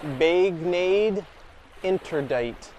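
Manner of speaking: anglicized "Baignade Interdite" read slowly; sounding out "Baignade" as bag-nade, "Interdite" as inter-dite; reading French sign. Shown as a demonstration. Tourist reading a French sign aloud, slow anglicized. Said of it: "It says".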